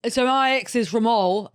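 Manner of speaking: usual speaking voice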